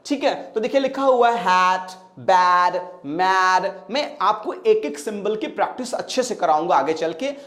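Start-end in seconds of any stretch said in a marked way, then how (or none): none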